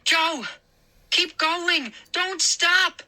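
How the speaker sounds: high frantic urging; frantic cry. A narrator's reading. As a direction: pull him along with your voice. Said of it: "Moose cried, frantically pulling".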